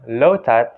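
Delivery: "lotus" said "lo-tat"; Thai-style "lotus"; Thai pronunciation. Shown as a demonstration. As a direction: demonstrate "lotus" without the final S. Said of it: "See the difference".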